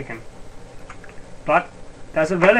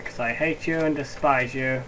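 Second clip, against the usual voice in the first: ordinary talk, nothing marked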